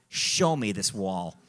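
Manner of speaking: low, slow mock hero voice; comic low-voiced bravado; mock-heroic. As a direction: drop low and slow, playing the cool hero. Mock hero taking on the wall.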